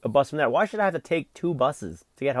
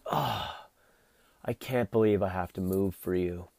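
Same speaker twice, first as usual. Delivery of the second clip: lower, softer put-upon whine; mock annoyed woman; 6 semitones lower, 7 dB quieter than usual. Retelling the woman who moved seats, mock grumbling. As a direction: sneer at how put out she was. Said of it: "she was so like".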